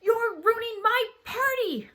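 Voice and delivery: very high, slow, loud shout; furious yell. A narrator's reading. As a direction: yell it in a fury, stretching each word. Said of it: "I got so mad, I yelled".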